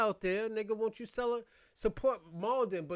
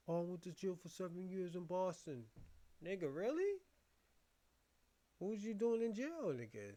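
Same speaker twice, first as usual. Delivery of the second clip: mock interview voice; 9 dB quieter than usual. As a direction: mumble it like the guy being interviewed. Acted exchange about jail.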